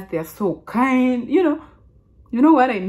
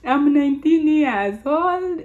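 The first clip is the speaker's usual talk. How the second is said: high, slow self-mimicry; imitating her earlier careful speech; former way of speaking; 7 semitones higher, slower than usual. Shown as a demonstration. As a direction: stretch it out in a raised put-on voice. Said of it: "This is how I was speaking".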